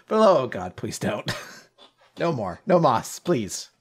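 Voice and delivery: ordinary talk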